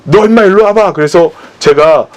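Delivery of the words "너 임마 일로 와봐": higher, clipped mock scolding; imitated angry bark. Offended worker snaps at the preacher, mock angry bark. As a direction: play the offended worker snapping at him. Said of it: "막 화를 내면서".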